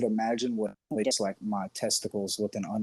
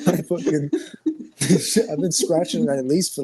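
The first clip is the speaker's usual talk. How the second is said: laughing out the words, louder and higher; 6 semitones higher, 7 dB louder than usual. Real, not acted. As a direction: crack up mid-sentence. Laughing at own confession.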